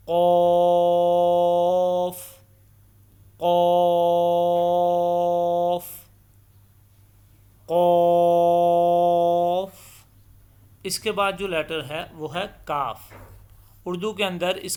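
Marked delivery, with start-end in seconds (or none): 0.1-9.7 قوف held very long, loud and thick, three times; long Quranic recitation. Shown as a demonstration. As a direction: chant قوف in a sustained qari tone. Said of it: "کیسے پڑھیں گے؟". (قوف کو تجوید کے ساتھ موٹا اور بہت لمبا کھینچ کر پڑھیں)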